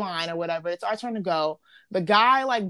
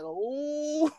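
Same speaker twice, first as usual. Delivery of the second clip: lightly mimicked cousin, higher-pitched ooh; a drawn-out, higher ooh imitating an onlooker; cousin's reaction; 7 semitones higher, 4 dB quieter than usual. Friend imitating how the cousin looked at her on the ride, a higher mock ooh. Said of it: "cousin's looking at me like".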